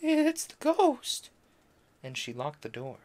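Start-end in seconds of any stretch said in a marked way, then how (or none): 0.0-1.3 high, slow, frightened cry; trembling voice. Acted. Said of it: "in a trembling voice".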